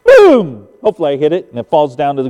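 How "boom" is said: loud, booming shotgun blast; imitated gunshot. Hunter fires at the rising bird, imitated gunshot. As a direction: boom it out like a shot.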